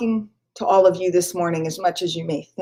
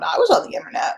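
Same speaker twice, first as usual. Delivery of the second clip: high, airy voice for the nerds; lightly mocking the nerds who were online; 11 semitones higher, much faster than usual. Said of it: "Some of you nerds are going".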